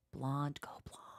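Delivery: hushed tempting whisper, no voice; devil whisper. Acted. The devil in her head tempts her in a whisper. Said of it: "it whispers to me".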